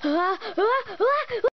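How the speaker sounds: rising ah ah before a sneeze; climbing sneeze wind-up; build-up before a sneeze. Acted. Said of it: "I gotta sneeze".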